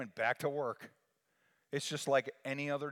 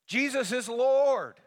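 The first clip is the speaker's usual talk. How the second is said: much higher, much louder, slow proclamation; proclaimed shout; 13 semitones higher, 13 dB louder, slower than usual. Shown as a demonstration. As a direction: cry it out to the whole church. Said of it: "I say it".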